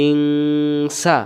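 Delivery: ইংসা with the nasal ng held long and humming before sa; long nasal ikhfa. Shown as a demonstration. Quran teacher showing students the ikhfa nasal hold. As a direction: stretch ইংসা into a slow humming nasal, very drawn out. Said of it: "ইংসা কেন হচ্ছে?".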